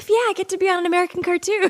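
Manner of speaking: high, fast gleeful burst; imitated giddy excitement; excited young self. Acts out her young excited self.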